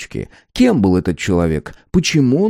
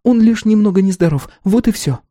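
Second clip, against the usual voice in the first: higher-pitched, hurried reassurance; hasty reply; 11 semitones higher than usual. A narrator's reading. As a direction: brush it off quickly in a raised voice. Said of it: "поспешно ответила она".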